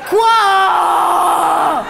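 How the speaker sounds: loud laughing "Quoi?"; outraged shout. Acted. Comedian reacts to the audience, loud and laughing. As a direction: bark it out, shocked and amused.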